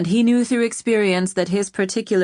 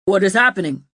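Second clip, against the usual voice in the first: alarmed, raised question; startled exclamation; 5 dB louder, faster than usual. A narrator's reading. Severin in the tent, alarmed exclamation. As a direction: jolt up in sudden alarm. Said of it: "Severin exclaimed".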